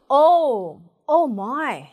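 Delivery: mild demonstrated astonishment; surprised. Shown as a demonstration. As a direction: exclaim as if taken aback. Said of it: "놀랬을 때 주로 하는". (놀란 듯이)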